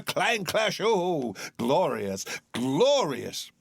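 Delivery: ordinary delivery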